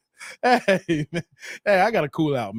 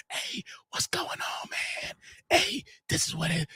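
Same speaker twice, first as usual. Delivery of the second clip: hushed, breathy whisper of a caller pretending to be alone; mock whisper; 4 semitones lower, 11 dB quieter than usual. Streamer mocking a scammer caller, whispering. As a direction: whisper it like you're hiding something. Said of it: "God is saying".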